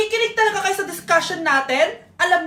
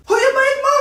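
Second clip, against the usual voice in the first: much higher, loud call after someone walking away; 8 semitones higher, 4 dB louder than usual. Acted. Vendor calling after customers who skipped the bill, loud and high. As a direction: yell after them for the payment.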